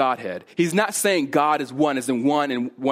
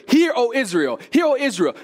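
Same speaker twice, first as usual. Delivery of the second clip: raised, hurried proclamation of the wrong version; mock wrong opening; 9 semitones higher, 4 dB louder than usual. Preacher mimicking a wrong opening, higher and louder. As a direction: voice the misreading a touch higher and louder. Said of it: "He doesn't begin it with".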